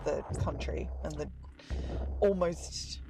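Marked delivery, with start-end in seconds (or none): none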